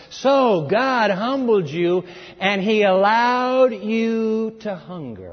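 very slow, loud solemn proclamation; solemn Moses. Retold or imitated. Moses addressing the grown children, slow and solemn. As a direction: intone it like a prophet's farewell.

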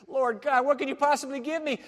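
high, loud plea to God; exasperated protest. Acted. Abraham's protest to God, acted.

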